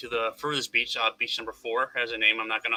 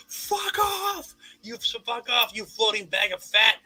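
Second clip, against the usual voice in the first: retold shout, pitched way up; mock shouting; 10 semitones higher, slower than usual. Kayaker cursing off a manatee, mock shouting. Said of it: "shouting at this thing".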